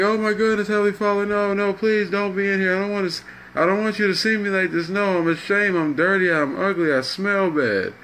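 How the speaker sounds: high, hurried pleading; acted panicked shame. Ashamed crackhead begging Heavenly Father to leave, acted panicky plea. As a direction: beg not to be seen, pitch falling away at the end. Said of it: "he runs in shame and hides".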